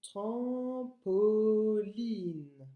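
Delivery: Trampoline very slowly, each syllable stretched; "Trampoline" as tram, po, line, long vowels; broken into syllables. Shown as a demonstration. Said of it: "pour le décomposer".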